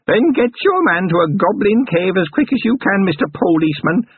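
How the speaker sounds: put-on high old goblin voice, pressing; urgent goblin crone. A narrator's reading. Goblin granny urging Carrot to a cave, high crone. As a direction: urge him like an old goblin.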